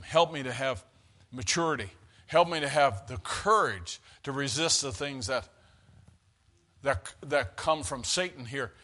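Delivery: soft, low plea to God; hushed prayer. Real, not acted. Preacher asking the Lord for wisdom and courage, hushed prayer. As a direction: drop low and quiet, asking humbly. Prays aloud within the sermon.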